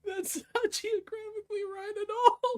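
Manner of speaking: very high, squeaky laughing voice; helpless laughter. Real, not acted. Cracking up at own wrong geography, helplessly laughing. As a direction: squeal it out through real laughter.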